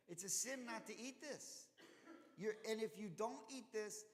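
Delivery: high-pitched, exasperated scolding; retold rebuke. Moses scolds Aaron's sons over the uneaten offering, mock rebuke. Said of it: "he rebukes Aaron".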